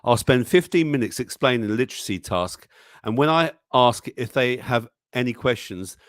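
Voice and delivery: ordinary delivery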